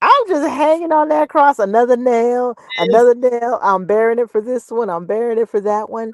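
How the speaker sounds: mock crucified martyr groaning through it. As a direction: play the long-suffering saint on the cross. Mock Jesus on the cross.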